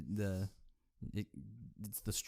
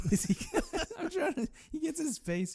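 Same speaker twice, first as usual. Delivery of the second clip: usual speaking voice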